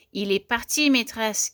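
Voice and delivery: ordinary delivery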